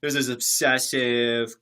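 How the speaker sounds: ordinary talk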